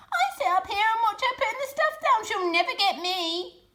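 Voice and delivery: high, loud gloating screech; shrieking rat. A narrator's reading. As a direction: screech it at the foxes, cackling. Rat boasting.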